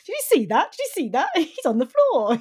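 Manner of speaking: high, loud gleeful crowing; gloating, excited shouts; gleeful Dolph. Retold or imitated. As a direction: crow over the fallen man. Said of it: "and then Dolph's like".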